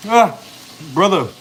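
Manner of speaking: ordinary talk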